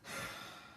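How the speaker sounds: soft breathy sigh, letting the breath go; a quick, quiet demonstration sigh; demo sigh. Shown as a demonstration. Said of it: "sighing and letting the breath go".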